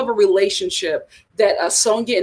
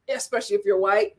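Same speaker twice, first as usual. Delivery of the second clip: usual speaking voice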